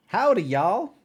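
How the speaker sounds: put-on Southern accent, playful; mock Southern accent. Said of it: "you a Southern Belle".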